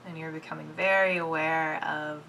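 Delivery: soft, unhurried meditation voice; quiet, soothing body-awareness guidance; guided meditation. Real, not acted. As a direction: guide them gently, with long pauses between phrases. Leads a meditation.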